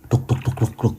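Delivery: soft, toneless thudding "duk"; imitated running footsteps. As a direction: mimic distant running feet under the breath. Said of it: "denger ada suara orang lari". (tiruan suara langkah lari, pelan)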